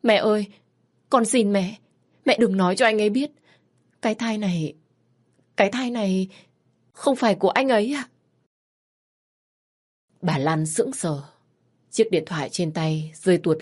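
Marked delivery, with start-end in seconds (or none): 0.0-8.1 higher, louder desperate begging; cling to her mother and plead; pleading daughter. Acted. Daughter begging her mother, desperate and higher. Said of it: "van xin".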